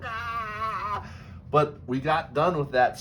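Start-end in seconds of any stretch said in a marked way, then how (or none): 0.0-1.1 high excited squeal; excited yelp. Retold or imitated. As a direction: squeal with delight. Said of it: "And I'm going".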